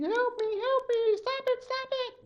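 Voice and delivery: very high, desperate pleading cry; crying for help. Acted. Girl carried up to the heavens, high pleading cry. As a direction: beg for rescue in a thin high squeal.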